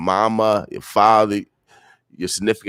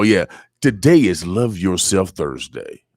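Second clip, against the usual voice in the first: ordinary delivery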